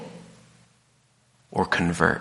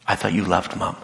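usual speaking voice

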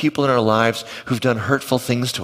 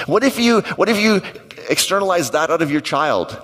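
excited; 8 semitones higher than usual. Real, not acted. Pastor urging parents to try something new, excited. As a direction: burst out with the question.

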